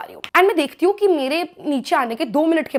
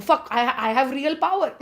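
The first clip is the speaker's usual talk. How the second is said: ordinary delivery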